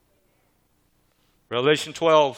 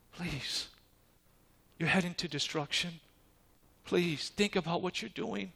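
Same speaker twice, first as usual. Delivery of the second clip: soft, gentle pleading as God; hushed, tender plea; calm pleading; 4 semitones higher, 6 dB quieter than usual. Acted. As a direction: quietly beg him to turn back. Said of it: "And God and all calmly".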